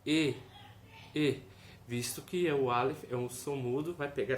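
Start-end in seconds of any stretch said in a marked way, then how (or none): 0.1-1.4 E vowel demo; E sound demo. Shown as a demonstration. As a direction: clear E vowel held. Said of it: "tem o som de".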